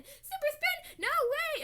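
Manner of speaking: high, loud, slow squeal; thrilled cartoon girl squealing; excited squeal. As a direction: shriek it in disbelief like a kid. Other girl answers excitedly.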